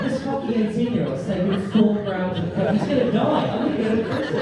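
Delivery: loud, high-pitched mock panic; panicking. Actor tells his friends about the overdose, panicking. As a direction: play it as a frantic, hammed-up freak-out. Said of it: "they're all really panicking".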